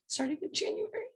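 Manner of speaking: breaking down mid-sentence, about to sob; snotty crying. Real, not acted. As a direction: voice cracking high into tears. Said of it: "'cause I'm probably gonna ugly cry".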